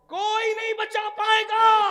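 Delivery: slow, high shout on पाएगा; thundering warning. Shown as a demonstration. Preacher repeating the warning to the crowd as a slow shout. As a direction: stretch पाएगा out in a slow, loud, high fall.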